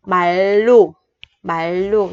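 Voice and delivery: 발로 with a clear double L, bal-lo; 발로 with L, not R; pronunciation demo. Shown as a demonstration. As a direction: say 발로 as bal-lo, both consonants an L. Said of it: "mình sẽ đọc thành chữ L".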